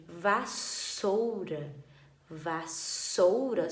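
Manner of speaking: Vassoura said very slowly, syllable by syllable; slow dictation. Shown as a demonstration. Teacher dictating Vassoura slowly to pupils. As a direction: drag out Vassoura so children hear the s. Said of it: "som de S".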